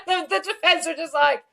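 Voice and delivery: high, loud, laughing delivery; cracking up. Real, not acted. Host retelling a witness's comeback, cracking up. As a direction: push the words out through real laughter.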